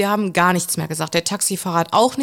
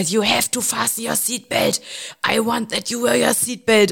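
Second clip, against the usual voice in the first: lecturing schoolmaster; 3 semitones higher than usual. Retold or imitated. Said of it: "Mit hoch erhobenem Zeigefinger hat er vor mir gestanden".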